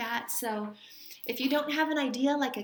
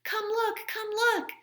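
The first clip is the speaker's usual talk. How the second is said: much higher sing-song call, lightly louder; calling up the stairs; 12 semitones higher, 4 dB louder than usual. Acted. Henry and father calling mother upstairs, high excited call. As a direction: call up excitedly to bring her down. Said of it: "they called up the stairs".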